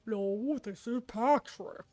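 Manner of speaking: slow, lightly put-on cartoon starfish voice; a hint of a dopey cartoon starfish; Patrick voice. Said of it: "It's not a very good Patrick voice".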